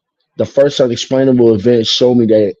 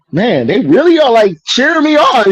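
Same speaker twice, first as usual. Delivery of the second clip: sarcastic quote, pitched much higher; mock amazement; 11 semitones higher than usual. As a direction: fake wonder, voice climbing high and thin. Sarcastic quote about being cheered.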